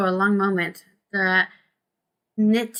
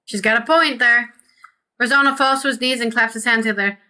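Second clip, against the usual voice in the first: ordinary talk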